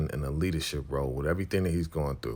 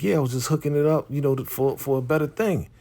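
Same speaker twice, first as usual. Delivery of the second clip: high, quick retold excuse; defensive excuse; 8 semitones higher, faster than usual. D-Mac explaining the hook-up to Tommy, defensive. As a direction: imitate someone justifying himself, pitched up.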